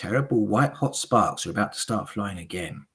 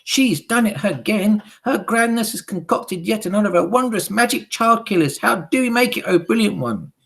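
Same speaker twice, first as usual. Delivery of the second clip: high, loud eager hag voices; high witch chorus; 13 semitones higher, 8 dB louder than usual. Acted. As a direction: agree like fawning followers. Witches flatter her.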